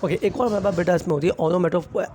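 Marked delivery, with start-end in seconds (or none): none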